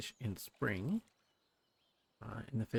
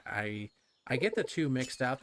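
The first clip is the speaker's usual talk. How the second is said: ordinary talk, nothing marked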